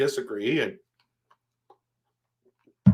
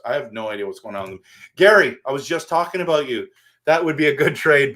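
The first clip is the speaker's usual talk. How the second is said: normal talking voice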